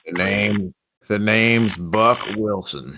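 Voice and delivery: slow, drawn-out farmer twang; country twang. Acted. Co-host invents a farmer name, slow country twang. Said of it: "I can do a little twang".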